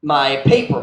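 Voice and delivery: ordinary talk, nothing marked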